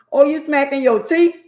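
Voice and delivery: high, loud scolding mama; mock mama yell. Mama yelling at her daughter for getting smart. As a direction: snap it out like a furious mother.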